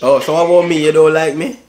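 put-on Jamaican patois accent. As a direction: chat in a fake Jamaican accent. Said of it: "Stop that fake ass accent".

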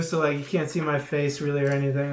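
usual speaking voice

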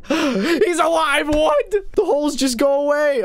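stretched-out disbelief; amazed. Real, not acted. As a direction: marvel that he lives. Amazed the ragdoll lives.

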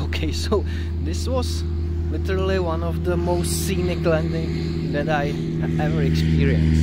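loud, thrilled exclamation; excited. Real, not acted. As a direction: burst out with excitement over the view. Excited after scenic landing.

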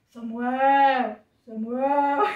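loud, booming man's voice; put-on mighty divine voice; voice of God. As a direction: call the name strong and sustained. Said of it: "Daí vamos fazer a voz de Deus".

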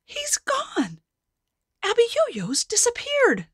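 high excited shout; joyous cry. Acted. Townsfolk cheering that the giant is gone. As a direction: cheer in relief. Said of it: "People looked out the windows".

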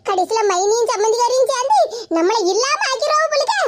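normal talking voice

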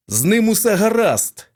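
loud, high joyful cry; excited exclamation. A narrator's reading. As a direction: shout the good news. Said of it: "вигукнув Кассиді".

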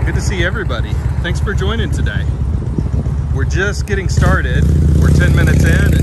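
high, loud, beaming hellos to the chat; excited greeting. Real, not acted.

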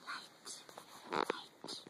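breathy whispered repeat of the word; whispered echo. Acted. Parent whispering bedtime words to baby. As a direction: whisper it back softly.

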